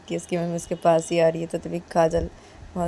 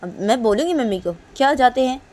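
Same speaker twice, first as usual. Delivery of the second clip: raised, higher tattletale voice; bratty girl threatening; 4 semitones higher, 6 dB louder than usual. Acted. Girl threatening to tell her friend's mother, raised and taunting. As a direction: taunt that you'll tell mum. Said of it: "तो तभी रेशमा बोलती कि".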